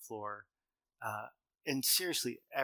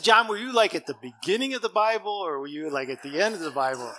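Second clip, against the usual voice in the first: much higher and louder, pleading; exasperated question; 6 semitones higher, 12 dB louder than usual. Retold or imitated. Pastor begging John to narrow down the verse, loud and exasperated. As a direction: press him, voice up high and loud, falling back toward normal at the end.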